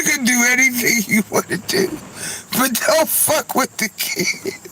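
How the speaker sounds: high voice through laughter; laughing while talking. Real, not acted. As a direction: talk through the giggles. Still laughing while telling.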